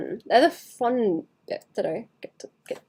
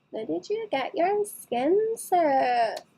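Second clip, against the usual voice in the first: put-on higher, slow valley girl question; slow higher valley girl drawl; 7 semitones higher, slower than usual. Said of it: "lean into it".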